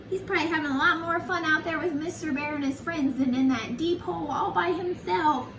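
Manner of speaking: ordinary delivery, nothing marked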